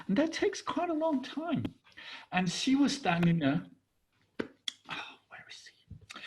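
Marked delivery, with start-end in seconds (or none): 4.9-6.2 anxious breathy whisper to herself; worried whisper. Retold or imitated. Wife waiting at home, whispering in worry. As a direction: fret under the breath.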